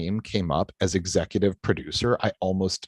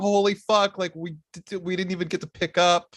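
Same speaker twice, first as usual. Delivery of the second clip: panicked; 9 semitones higher, faster than usual. Retold or imitated. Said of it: "they're like".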